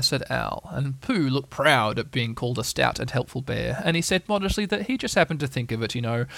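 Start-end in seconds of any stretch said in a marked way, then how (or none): none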